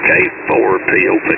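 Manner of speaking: normal talking voice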